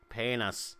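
half-hearted Irish accent, low and soft. Shown as a demonstration. Comedian retrying for Irish listeners, weak Irish accent. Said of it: "I can't do an Irish accent".

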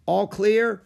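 high, loud questioning call. A narrator's reading. Girl calls to boy once the rocks stop, calling out. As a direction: call it across the slope. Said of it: "she called to Jack".

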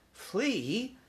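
high, rising, puzzled question; young mouse taken aback; bewildered. A narrator's reading. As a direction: query it in disbelief. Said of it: "Ralph was bewildered".

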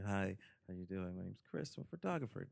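soft, polite introduction in careful standard diction; quiet, mannerly code-switched voice; Chris, polite register. Retold or imitated. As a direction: mimic a young man being politely proper for his girlfriend's parents, quietly. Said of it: "when Chris was talking to their parents".

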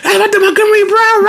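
high, gushing fan voice. Retold or imitated. Imitating a gushing stranger complimenting her earrings. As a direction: gush over the earrings in a sing-song high voice. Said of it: "It was like".

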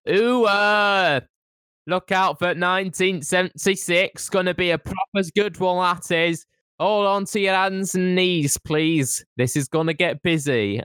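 chirpy Yorkshire local; Yorkshire accent. Retold or imitated. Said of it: "in Yorkshire accent".

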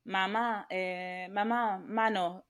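lightly voiced small child, drawn out; little son. Mother imitating her three-year-old asking for a hand, toddler voice. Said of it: "o me dice a mí".

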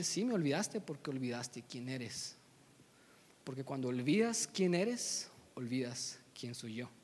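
low, soft, measured reproach; grave words from a father, falling away; solemn father. Acted. Mufasa speaking to Simba from the sky, solemn and low.

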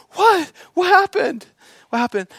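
high-pitched imitation of a startled wife; loud, urgent mock alarm; alarmed questioning. Voices startled wife at the stove.

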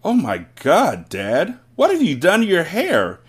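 mild shocked shout; son's shout. A narrator's reading. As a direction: cry it out in shock at Dad. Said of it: "the son shouted".

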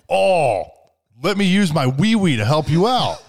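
high, loud mock child voice; eager little kid. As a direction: play a helpful kid, hammed-up. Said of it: "this kid's like".

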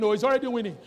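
ordinary delivery

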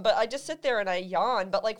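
ordinary talk

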